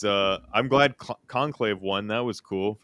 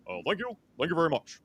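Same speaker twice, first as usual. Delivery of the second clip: softer, smoother [name] thank you; a cleaner [name] croon; proper [name] voice; 5 semitones higher, 7 dB quieter than usual. Retold or imitated. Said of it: "more proper drunk [name]".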